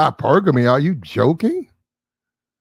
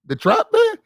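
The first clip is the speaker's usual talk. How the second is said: incredulous. Real, not acted. Mocking [name]'s persona in disbelief.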